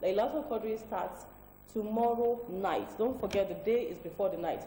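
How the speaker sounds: ordinary talk, nothing marked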